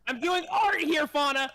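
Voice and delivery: high, loud playful outburst; mock protest. Real, not acted. Defending her acting to Fauna with a high, loud outburst. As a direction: shout it as a proud artist.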